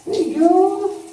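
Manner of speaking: chirpy pet-talk question. Real, not acted. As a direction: coo up high at the little pirate. Cooing to pet.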